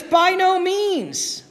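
"By no means." drawn out slowly, NO pitched far up; slow emphatic. Shown as a demonstration. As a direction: stretch every word, lift "no" high. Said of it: "It's an emphatic exclamation point".

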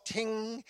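drawn-out, high guitar "ting"; imitated wrong note. Mimicking the guitarist's bad opening note, a ringing ting. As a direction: ring out a long off-key guitar note. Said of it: "like, the completely wrong note".

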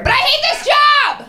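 high, loud shout of frustration; angry yell. Acted. Fed-up comic yelling at the crowd about her job.

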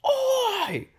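very high, hammed-up screech; piercing mock shriek; screaming Cockney wife. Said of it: "your wife just screams".

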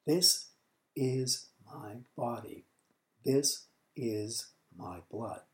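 slow, with "is" weighted; slow solemn repeat. Shown as a demonstration. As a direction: let each word sink in. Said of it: "preacher repeats for weight".

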